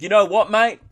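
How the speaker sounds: loud, high mock challenge; mock confrontation. Bogan answers critics of his slang, mock-loud challenge.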